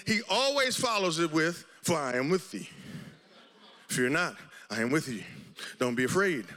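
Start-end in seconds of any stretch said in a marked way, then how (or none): 1.8-6.5 low, soft, unhurried comfort; tender reassurance. Retold or imitated. Pastor voicing God comforting a frightened believer, low and tender. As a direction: speak God's comfort gently. Quoting God's comfort softly.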